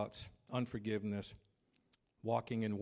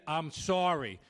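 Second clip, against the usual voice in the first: higher, louder insistent sorry; yelled apology; 6 semitones higher, 7 dB louder, slower than usual. Retold or imitated. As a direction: belt out the apology as if arguing. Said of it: "Why are you yelling?".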